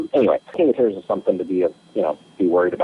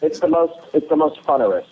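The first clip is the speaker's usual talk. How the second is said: playful joking; 9 semitones higher than usual. Pretend. Jokes on funner.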